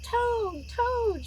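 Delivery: high, loud call for Toad; shouting. A narrator's reading. Frog shouting at Toad's door to wake him. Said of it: "shouted Frog".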